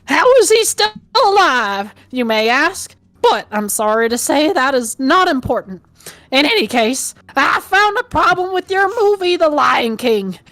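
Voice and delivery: high, put-on old man with southern accent; Shakespeare. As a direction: lecture them slowly as a theatrical old Southerner. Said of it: "like, a southern accent".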